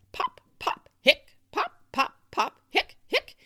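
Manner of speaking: loud punchy bursts; rapid-fire popcorn mixed with hics; noisy pops and hiccups. A narrator's reading. Narrator imitating noisy kitchen, loud pops and hiccups. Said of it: "it got very noisy".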